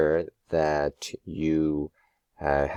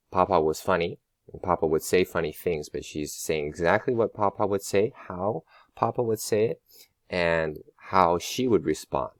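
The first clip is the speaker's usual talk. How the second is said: normal talking voice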